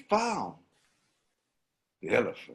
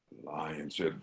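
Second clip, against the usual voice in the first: plain reading voice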